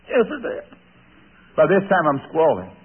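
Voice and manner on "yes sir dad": put-on scared boy. Scared boy answering father.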